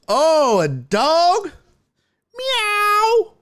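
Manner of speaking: put-on high, loud child meowing; silly kid voice. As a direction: squeal it like a goofy little kid.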